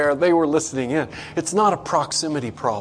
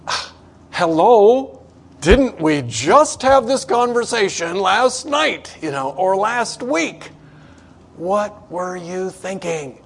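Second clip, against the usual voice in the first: much higher and louder, slow, drawn-out "Ah, hello"; fed-up parent scolding a knucklehead kid, slowly; exasperated parent; 6 semitones higher, 7 dB louder, slower than usual. Retold or imitated. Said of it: "the parent going".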